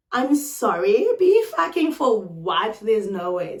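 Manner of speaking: loud, drawn-out disbelief; affronted, loud comeback; incredulous retort. Retold or imitated. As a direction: throw it back in indignant disbelief. Incredulous comeback in retold quarrel.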